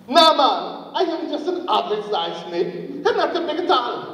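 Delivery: mock dismissive retort; staged disagreement. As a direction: play the doubter in a pretend argument. Acts other side of staged argument.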